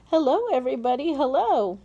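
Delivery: high, loud and fast cheerful hellos; calling out. Acted. Small boy greeting the rooftop crowd, calling out happily. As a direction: hail the crowd below with a cheery call. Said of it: "laughing and waving and calling out".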